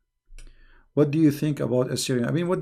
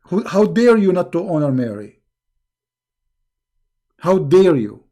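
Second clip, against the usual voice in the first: louder, indignant how dare you; indignant rebuke; 4 dB louder than usual. Real, not acted. Host scolding a viewer who dishonors Mary, indignant. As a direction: scold the viewer with rising outrage.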